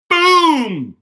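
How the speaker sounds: shrieked high, loud cartoon boom; hammer boom. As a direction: yell boom like a hammer blow. Hammer blow effect.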